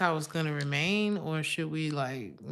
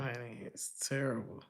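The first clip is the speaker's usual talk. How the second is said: usual speaking voice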